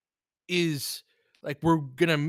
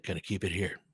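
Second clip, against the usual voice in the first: unvoiced, much softer; quiet frustrated whisper; whispered aside; 6 semitones lower, 7 dB quieter than usual. Real, not acted. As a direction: breathe it out under the breath. Frustrated whisper during play.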